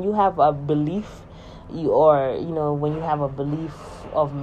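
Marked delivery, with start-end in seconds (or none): none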